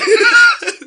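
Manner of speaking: high, loud laugh that breaks out; a sudden high burst of laughter. Real, not acted. Laughing while telling the story.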